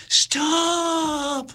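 high, drawn-out shouted stop; shouted command. A narrator's reading. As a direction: hold the shout, straining with all his will. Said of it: "and shouted".

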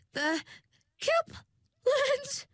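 very high, shrill, panicked question; hysterical shriek. Acted. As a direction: shriek it out in disbelief. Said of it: "with a hysterical shrilliness".

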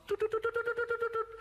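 very high toot toot toot; playful high tooting noises. Acted. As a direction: play it like a little horn. Sound of trousers' spots tooting.

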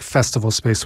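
ordinary talk

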